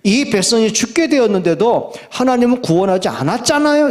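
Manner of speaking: complaining to God. Acted. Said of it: "이렇게 나오는 거예요". (하나님께 대들며 따지는 말투)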